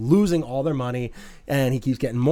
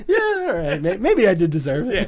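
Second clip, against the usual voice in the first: high-pitched, laughing admission, acted out; mock sheepish concession; 5 semitones higher, 3 dB louder than usual. Fan voices Norton's caught-out reaction, high sheepish mock. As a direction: act out conceding the point with a laugh. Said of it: "he was just kinda like".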